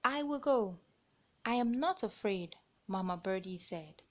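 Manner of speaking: lively picture-book narration; reading to children. A narrator's reading. Storyteller reading a bird tale to young listeners. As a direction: read it out like storytime.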